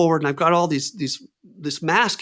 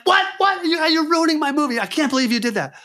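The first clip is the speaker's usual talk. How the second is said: incredulous upset; 14 semitones higher than usual. Retold or imitated. Director upset about edible.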